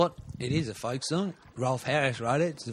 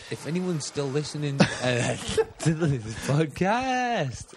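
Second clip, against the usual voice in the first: breaking up with laughter, higher toward the end; laughing; 4 semitones higher, 4 dB louder, slower than usual. Real, not acted. Host wondering if listeners remain, laughing as he speaks. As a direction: laugh through it, drawing out the last words. Cracks up addressing the podcast listeners.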